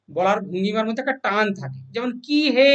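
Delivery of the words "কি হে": হে stretched long like calling someone; stretched হে, a calling drawl; drawn-out call. Shown as a demonstration. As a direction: draw out the হে as a call. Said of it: "টেনে টেনে উচ্চারণ করছি".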